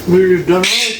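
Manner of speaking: talking with mouth full; words round a bite of food. Real, not acted. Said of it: "Caught you with your mouth full".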